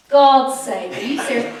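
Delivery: retold exasperation. Re-enacts dismay at lost bet.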